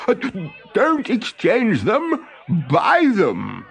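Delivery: high, slow, stammering inventor; a flustered inventor pressing his idea; eager inventor. Acted. Olaf urging Thornogson at the market, high and slow.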